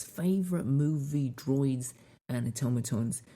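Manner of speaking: ordinary delivery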